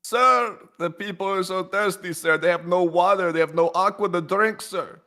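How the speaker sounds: high mock aide pleading; hammed-up aide reporting thirst. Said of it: "But I was like".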